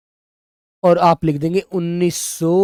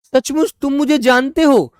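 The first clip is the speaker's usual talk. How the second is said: higher, amazed question; surprised exclamation; 7 semitones higher than usual. Shown as a demonstration. Teacher acting out example sentence, amazed and higher. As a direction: sound truly amazed. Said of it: "दूसरा वाक्य हम कहेंगे".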